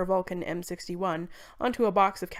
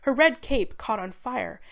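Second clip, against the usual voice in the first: ordinary delivery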